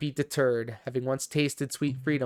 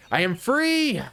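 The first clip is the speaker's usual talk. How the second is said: joyful cry; 10 semitones higher, 4 dB louder than usual. Acted. Daughter exults in freedom.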